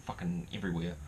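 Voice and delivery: normal talking voice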